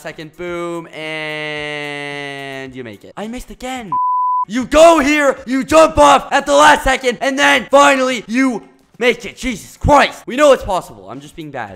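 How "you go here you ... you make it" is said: much louder, higher, eager explanation; excited. Shown as a demonstration. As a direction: push it with energy this time. Demonstrating the jump again.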